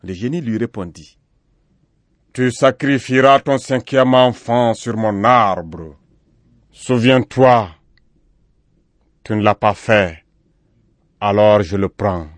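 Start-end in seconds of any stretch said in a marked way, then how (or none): 2.3-12.3 loud, slow, solemn genie decree; forceful tree-spirit verdict; stern genie. A narrator's reading. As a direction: pronounce judgement with booming weight. Genie sternly repeats his decree.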